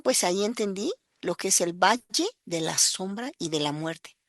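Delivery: ordinary delivery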